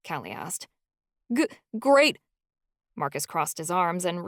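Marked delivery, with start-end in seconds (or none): 1.3-2.2 teeth chattering, forced brightness; shivering stammer. Acted. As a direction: stutter through the cold.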